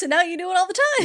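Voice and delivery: high, loud, fast mock voice; hammed-up high complaint; Dan. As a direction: rattle it off squeaky. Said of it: "He's like".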